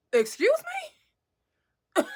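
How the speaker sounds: loud, high, drawn-out incredulous question; shocked disbelief. Retold or imitated. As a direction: stretch it out, voice shooting up in mock shock. Shocked reaction acted out.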